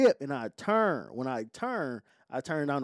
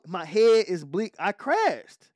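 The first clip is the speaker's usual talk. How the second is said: ordinary delivery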